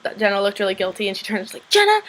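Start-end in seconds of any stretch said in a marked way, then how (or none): 1.7-2.0 high-pitched, accusing call-out; half-hearted retold scream, pitched way up; mild mock yell. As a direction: imitate an outraged girl confronting someone. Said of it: "she starts screaming at her".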